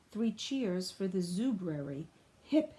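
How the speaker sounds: ordinary delivery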